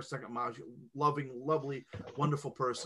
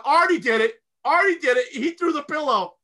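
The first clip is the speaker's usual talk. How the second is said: put-on kids blaming; 9 semitones higher, 7 dB louder than usual.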